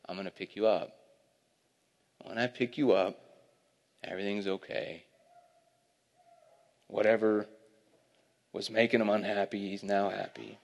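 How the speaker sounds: soft, low, tender reassurance; reassuring father. Retold or imitated. Father to crying child he lifts, soft reassurance. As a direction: soothe a crying child, softly and gently. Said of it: "for the father to say".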